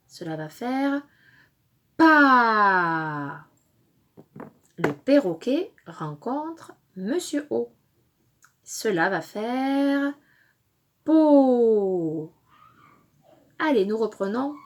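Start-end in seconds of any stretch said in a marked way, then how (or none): 2.0-3.5 loud, stretched "pa" sliding downward; drawn-out syllable. Shown as a demonstration. Teacher blends letters into syllable. 11.0-12.3 stretched "po" sliding steadily downward; drawn-out syllable. Shown as a demonstration. Teacher blends letters into syllable.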